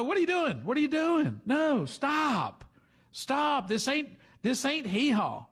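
high-pitched, incredulous objection; mock indignant protest. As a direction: cut the pandering speaker off in put-on disbelief. Acts out voters' indignant objection.

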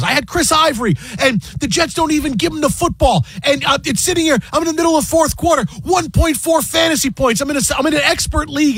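high-pitched mock rant; Michael Rappaport. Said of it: "I haven't seen you this upset".

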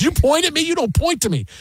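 high offended rebuke; indignant scolding. Retold or imitated. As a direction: scold him sharply. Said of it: "She goes".

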